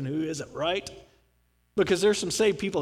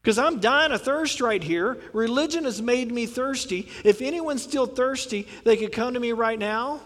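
higher, louder preaching cry; loud calling out; 5 semitones higher than usual. Retold or imitated. Jesus calling the thirsty crowd to come to him, loud. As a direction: call to the whole crowd. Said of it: "he stands up and says".

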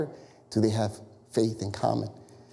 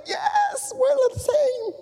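extremely high, shrieked excitement; ecstatic agreement; slower than usual. Acted. Two strangers sharing a denomination, ecstatic squeal. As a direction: shout it in a hammed-up squeal. Acted thrill at shared denomination.

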